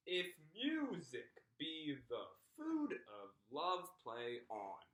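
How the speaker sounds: slow "If music be the food of love, play on", stressing every second syllable; poet's measured lilt on "If music be the food of love, play on", lifting each second syllable; iambic pentameter. Shown as a demonstration. Teacher showing how a poet says "If music be the food of love, play on", in iambic pentameter. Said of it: "it'd go a little bit like this".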